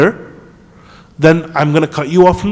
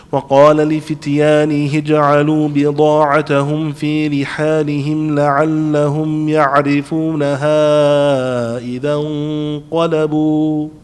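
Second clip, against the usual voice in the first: melodic chanted Quranic recitation; ترتيل قرآني; much faster than usual. Shown as a demonstration. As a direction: intone the verse with a steady chant. Recites the next ayah.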